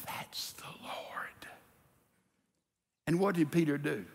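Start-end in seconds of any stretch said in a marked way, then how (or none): 0.0-1.5 hushed, breathless whisper. Retold or imitated. John tells Peter who is on shore, whispering. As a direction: breathe it, barely voiced. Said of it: "whispers to Peter".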